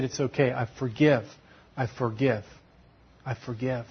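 soft, fading to almost nothing; quietly reassuring the weeping veteran; tender forgiveness. Retold or imitated.

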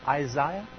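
imitated British "Isaiah", middle syllable like "eye"; British accent. Preacher imitating a scripture reader's British accent. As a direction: say "Isaiah" as eye-ZYE-uh, mimicking British English. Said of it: "with that wonderful British accent".

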